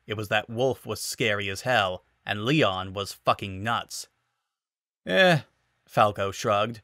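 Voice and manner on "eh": dismissive. Acted. Falco shrugs it off.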